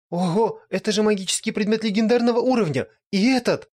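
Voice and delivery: high, loud thrilled gasp; excited lich. Acted. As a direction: gush over the loot. Lich excited over treasure.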